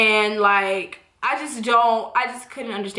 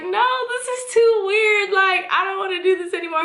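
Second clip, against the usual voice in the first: much higher, fast panicky protest; 8 semitones higher than usual. Retold or imitated. Backing out from her partner, high and fast. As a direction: squeal it out that it's too weird.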